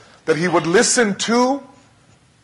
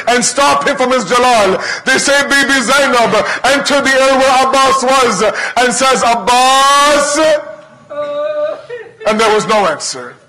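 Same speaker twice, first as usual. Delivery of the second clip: impassioned preaching; 5 semitones higher than usual. Acted. Preacher narrating Abbas and Zainab to the mourners, impassioned. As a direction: preach the climax with full force.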